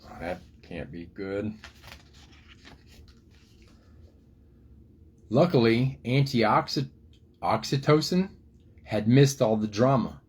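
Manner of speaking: lively picture-book storytelling voice; reading to children. A narrator's reading. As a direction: tell the story to little kids.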